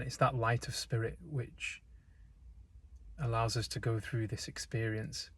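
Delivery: calm, unhurried meditation voice with long pauses; guided meditation. Real, not acted. Guide leading listeners into breath and stillness, calm meditation delivery. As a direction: guide them gently, leaving space between phrases.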